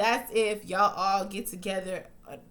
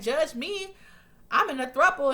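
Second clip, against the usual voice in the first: higher, incredulous retold comeback; indignant disbelief; 6 semitones higher, 4 dB louder than usual. Friend answering fear of being judged, indignant disbelief. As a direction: throw the question back, mock affronted.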